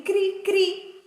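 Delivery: high-pitched little "cri, cri"; two high onomatopoeic chirps; cricket chirp imitation. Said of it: "uma palavra que representa um som".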